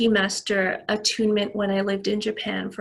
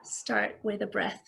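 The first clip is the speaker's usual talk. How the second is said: guided breathing. Real, not acted. Leads a breathing exercise.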